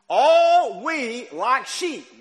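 "All" loud, high and drawn out; slow line led by a big, held "All"; stress on All. Shown as a demonstration. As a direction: punch and stretch "All", slow through the phrase. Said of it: "underline that word all".

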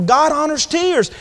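ordinary talk

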